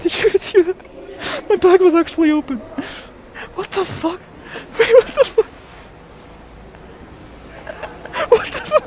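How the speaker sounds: very high, squealing real astonishment; shocked disbelief. Real, not acted. Streamer telling viewers his bag was open, high shocked disbelief. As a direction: squeak it out, stunned and incredulous.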